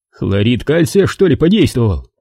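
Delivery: plain reading voice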